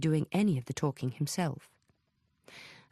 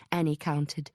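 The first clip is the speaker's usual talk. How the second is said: plain reading voice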